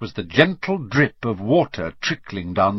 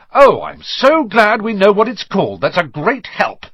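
raised, biting sarcasm; sarcastic snarl; 8 semitones higher, 5 dB louder than usual. A narrator's reading. Ron snaps at Hermione while being strangled, sarcastic snarl. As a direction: lay on the mock gratitude, loud and high at first. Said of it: "snarled Ron".

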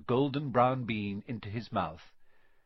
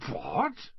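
drawn-out, high "What?" rising at the end; stunned boyish disbelief; incredulous question; 13 semitones higher than usual. A narrator's reading. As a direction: stretch "What?" upward in astonishment. Boy stunned by the revelation.

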